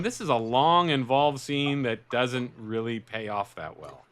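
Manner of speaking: slow, deflated sarcastic drone; bored critic. Retold or imitated. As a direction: drag it out like a bored viewer. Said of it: "I'm watching going".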